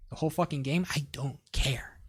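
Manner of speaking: low muttered aside; quiet aside. Real, not acted. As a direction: drop it down under your breath. Coach drops voice mid-rant.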